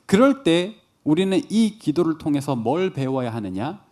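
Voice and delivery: ordinary talk, nothing marked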